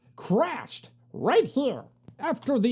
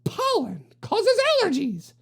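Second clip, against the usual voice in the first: usual speaking voice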